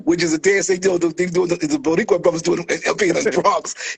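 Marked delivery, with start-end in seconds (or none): none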